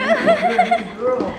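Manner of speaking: high, slow, laughing praise. Real, not acted. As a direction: coo it through a laugh.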